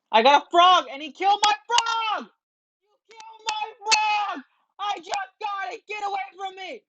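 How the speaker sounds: high, loud furious complaint; angry outcry. Real, not acted. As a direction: shout at the attacker. Opponent killed his frog.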